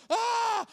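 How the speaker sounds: one high, loud pained wail; acted labor scream. Woman in labor beside the pastor, hammed-up scream. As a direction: scream in mock agony.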